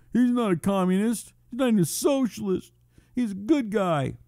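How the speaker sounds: high, put-on protesting voice; mocking a defender. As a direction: parody a naive objector, pitched well up. Mocks Obama's defenders, then answers.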